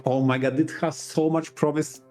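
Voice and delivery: ordinary talk, nothing marked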